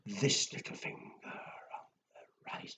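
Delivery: whispered punchline; hushed whispered rhyme ending. Shown as a demonstration. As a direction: whisper it secretly. Rhyme's last line whispered.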